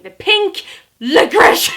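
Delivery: high, loud, slowed mock yell at the cast member; aggressive customer yell. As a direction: bark it like an angry grown man. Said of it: "He's so aggressive".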